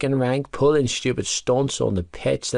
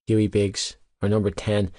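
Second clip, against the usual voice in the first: ordinary delivery, nothing marked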